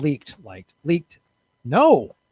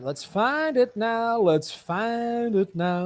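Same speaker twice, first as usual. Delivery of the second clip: high sing-song; 9 semitones higher, slower than usual. Real, not acted. Sing-song while searching.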